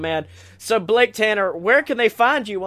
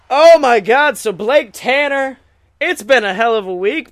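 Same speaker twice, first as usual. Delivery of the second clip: normal talking voice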